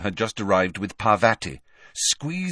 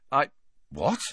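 halting, raised questioning tone; disconcerted; 5 semitones higher than usual. A narrator's reading. Harry thrown by Luna's question, disconcerted. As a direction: break off, thrown, then ask.

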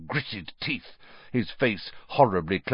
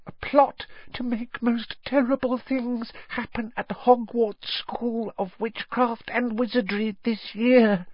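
high, squeaky devoted elf; a high elf voice pleading earnestly; house-elf Dobby; 14 semitones higher than usual. Acted.

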